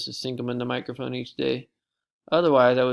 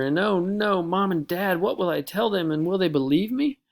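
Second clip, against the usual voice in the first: rising panic; 7 semitones higher, 3 dB louder than usual. Acted. Panic about parents.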